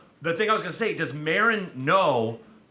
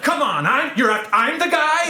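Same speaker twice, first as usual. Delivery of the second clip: indignant outburst, high and loud; high, exasperated mock yell, slowing at the end; Marc Maron; 12 semitones higher, 5 dB louder than usual. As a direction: imitate someone snapping in protest. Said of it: "he just snapped and he's like".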